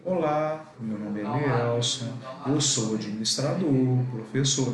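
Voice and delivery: slow, subdued greeting. Shown as a demonstration. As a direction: drag it out slowly, out of step with a fast talker. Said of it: "Você não vai chegar e falar pra ele".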